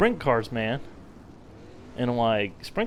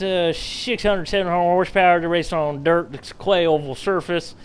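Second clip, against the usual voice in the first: hammed-up Southern race announcer, louder; fake Southern accent; 3 dB louder than usual. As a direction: play a good old boy calling the race in a fake Southern accent. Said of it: "Do a good Southern accent".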